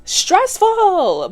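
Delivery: high, loud exasperated yelp; exasperated cry. Real, not acted. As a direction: throw up your hands and yelp it. Exasperated reaction to kids.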